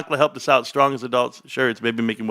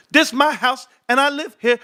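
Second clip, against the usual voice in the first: high-pitched stand-up bit, punchy; Eddie Murphy; 12 semitones higher than usual. Retold or imitated. Dad quoting comedy bits to his son in the truck, high put-on voice. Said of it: "like, Eddie Murphy".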